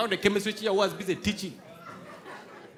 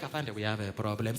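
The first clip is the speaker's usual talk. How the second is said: low officer voice; 12 semitones lower, 9 dB quieter than usual. Retold or imitated. Officers voiced in retold story.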